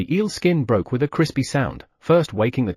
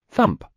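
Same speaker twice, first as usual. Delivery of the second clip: thump sound; 10 semitones higher than usual. Acted.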